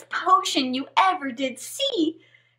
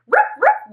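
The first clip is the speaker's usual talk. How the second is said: barking dog; 9 semitones higher, 5 dB louder than usual. Acted. Frog barks.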